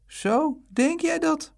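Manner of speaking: high-pitched, indignant grumble; mock-astonished high grumble; grumbling mother. A narrator's reading. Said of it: "mopperde moeder".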